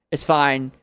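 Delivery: ordinary talk, nothing marked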